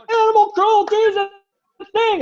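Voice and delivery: high, loud heckle; protesting audience member. Acted. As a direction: cry out in protest from the crowd. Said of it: "you hear some people going".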